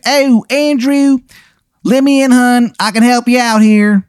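put-on terrible Maryland accent. Visitor asks Andrew to let him in, terrible Maryland accent. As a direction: plead to come in with an overdone Maryland accent. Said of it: "the worst Maryland accent you've ever heard".

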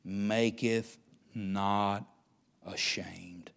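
slow, solemn reading of the verse, fading to a whisper; preacher slowly reading a Bible verse, then solemn; scripture reading. Acted. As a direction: read the verse slowly and reverently.

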